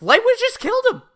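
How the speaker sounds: high, loud, rapid burst; emphatic exclamation. Real, not acted. Host argues Light would have killed L, emphatic. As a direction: blurt the conclusion out fast. Exclaimed conclusion about the plot.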